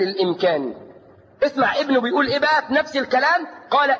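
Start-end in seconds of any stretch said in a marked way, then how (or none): none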